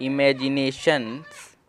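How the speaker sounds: "इमेजिनेशंस" said slowly and softly, joined up; slow pronunciation demo. Shown as a demonstration. Teacher joining the syllables of "इमेजिनेशंस" for learners, slowly. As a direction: draw "इमेजिनेशंस" out slowly as one word. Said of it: "फिर नेशन".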